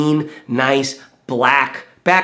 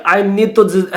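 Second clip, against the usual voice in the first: put-on German accent; 4 semitones higher than usual. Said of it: "crazy with the accent there".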